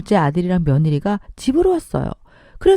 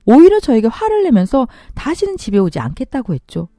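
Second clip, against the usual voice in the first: ordinary delivery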